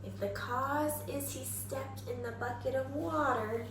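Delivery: ordinary talk, nothing marked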